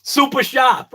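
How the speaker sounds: "Super sharp" with Boston dropped R's, high and punchy; Boston accent. Shown as a demonstration. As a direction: say "Super sharp" like a proud Bostonian, supa shahp. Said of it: "And it's S U P A".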